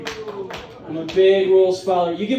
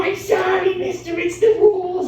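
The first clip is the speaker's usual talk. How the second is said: high, goofy pleading apology; Adam Sandler character; 9 semitones higher than usual. Acted. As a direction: plead like a silly overgrown kid. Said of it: "I talk like an Adam Sandler character".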